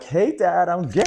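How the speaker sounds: high, loud mock-chirpy announcement. Imagined coming out to dad, mock announcement. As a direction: sing it out, stretching the last word. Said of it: "supposed to be like".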